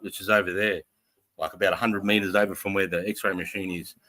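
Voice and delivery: ordinary delivery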